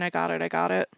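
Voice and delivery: usual speaking voice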